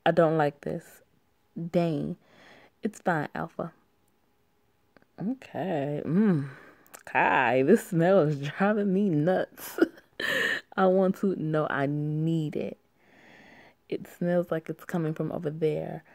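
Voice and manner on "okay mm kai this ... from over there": ordinary talk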